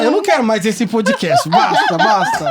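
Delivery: high laughing "basta". Pretend. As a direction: squeal it through laughter. Mock quitting the podcast.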